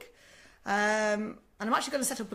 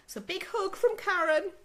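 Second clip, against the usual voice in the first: big hug; 10 semitones higher, 4 dB louder than usual. Real, not acted. Sending love to a struggling mum.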